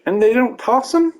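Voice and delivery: high-pitched incredulous outrage; indignant. Real, not acted. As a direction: protest in high, stunned disbelief. Outrage at unpunished hit batters.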